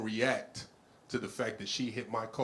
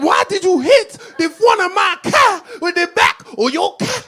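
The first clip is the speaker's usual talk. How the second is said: put-on high, shouted Haitian-accented screech; Haitian lady; 16 dB louder than usual. Comedian repeats the Haitian driver's accusation, high Haitian-accented shout. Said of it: "She said".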